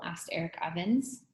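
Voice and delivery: ordinary delivery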